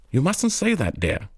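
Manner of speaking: high-pitched mother's mild scolding; chiding mother. A narrator's reading. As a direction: gently tell off a child in a high voice. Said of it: "the mother chid the child".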